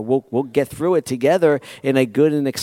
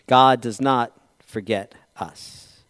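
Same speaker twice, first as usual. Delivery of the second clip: normal talking voice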